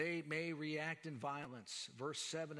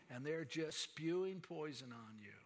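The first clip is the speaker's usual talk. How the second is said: ordinary delivery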